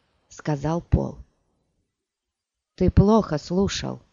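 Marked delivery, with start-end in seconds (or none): none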